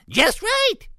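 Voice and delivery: very high, loud delighted cry; piglet squeal. A narrator's reading. Baby pig smashes the chair happily, squealing. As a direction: squeal it with glee. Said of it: "squealed Baby Sniff".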